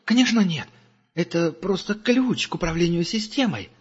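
high, hurried scared denial; the angel lies to Gerda, high and frightened; panicky denial. A narrator's reading. Captive denies in panic.

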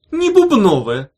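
high loud shocked cry; shocked loud cry of not diamonds. A narrator's reading. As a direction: blurt it out, stunned and loud. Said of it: "крикнула Улита".

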